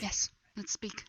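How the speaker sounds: soft whispered aside. Real, not acted. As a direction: breathe the words quietly.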